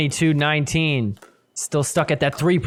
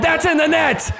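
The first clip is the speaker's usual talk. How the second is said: excited call; 8 semitones higher than usual. Real, not acted. Scoring call in a rally.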